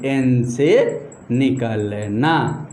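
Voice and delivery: slow and low, word by word; drawn-out dictation. Shown as a demonstration. Teacher applying the rule to the small transistor, drawn-out dictation.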